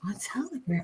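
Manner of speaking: whispered echo of the word; soft whispered repeat. Real, not acted. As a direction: whisper it back to yourself. Echoing partner, whispered to self.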